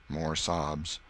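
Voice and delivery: ordinary delivery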